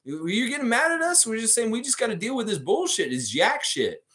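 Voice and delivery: high, exasperated mock rant; freaking out. Lifeguard ranting at the Raptor and wizard, exasperated. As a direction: pitch it way up, fed-up lifeguard. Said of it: "He's freaking out".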